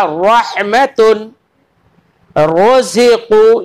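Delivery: रहमतुन and रोज़िक़ू recited slowly, ra heavy. Shown as a demonstration. Teacher recites examples to students, slow and heavy. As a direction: chant रोज़िक़ू slowly with a thick ra. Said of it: "हमने रा को पुर करके पढ़ा".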